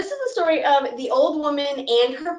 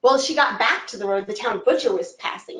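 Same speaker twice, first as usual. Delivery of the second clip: ordinary delivery, nothing marked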